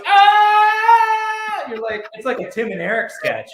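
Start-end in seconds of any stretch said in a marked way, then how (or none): 0.1-1.6 long, very high loud scream; sudden sustained yell mimicking a film character; imitated scream. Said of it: "stands up and just goes".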